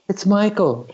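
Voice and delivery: very high-pitched imitated phone caller. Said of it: "I hear this voice, and he goes".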